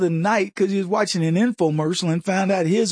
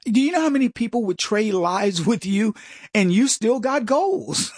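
ordinary talk, nothing marked

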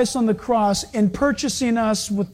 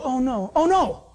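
hammed-up panicky God, higher at the end; sermon joke, a fussy God in a fret; a preacher playing a nervous God for laughs; mock fretting God; 5 semitones higher than usual. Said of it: "He doesn't go".

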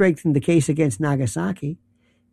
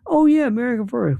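higher-pitched mimicked slogan cheer; mock agreement; 6 semitones higher than usual. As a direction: parrot the slogan's supporters. Mimicking slogan defenders and hedging.

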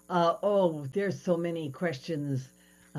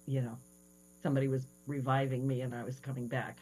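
ordinary talk

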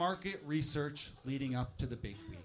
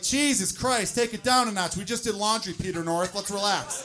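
loud, high, exasperated nagging; wife scolding him over the mess, loud and exasperated; 4 semitones higher, 6 dB louder than usual. Acted. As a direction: scold him like a fed-up partner. Stand-up acts out annoyed partner.

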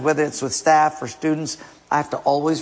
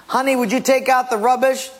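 asking wife; 9 semitones higher than usual. Acted. Acted wife asking husband.

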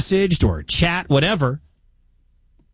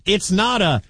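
ordinary talk

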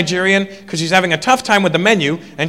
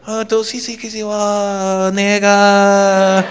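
slow, higher singsong mock babble; a higher, slow singsong kid babbling to his mom; mock kid gibberish; 4 semitones higher, 3 dB louder, much slower than usual. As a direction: sing the nonsense like a little kid.

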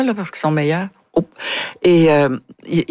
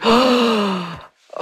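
shocked gasp; 5 semitones higher than usual. Retold or imitated. Reliving shock on set.

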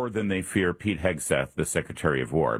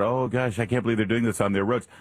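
mock complaining; 5 semitones higher than usual. Mimicking people complaining about roads.

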